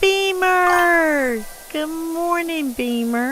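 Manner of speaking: high sing-song call to a pet; pet greeting voice. Real, not acted.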